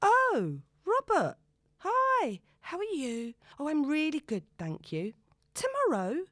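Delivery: high, bright forced-casual phone voice; trying to sound casual. A narrator's reading. Zoe answering Robert's call, forcing a casual tone. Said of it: "trying to sound casual".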